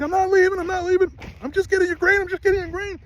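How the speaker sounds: very high, imitated calling-out voice; calling out reassurance. Man to upset horse while fetching grain, calling out reassurance. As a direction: call it up high to an upset animal, retold. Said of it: "I'm calling out to him".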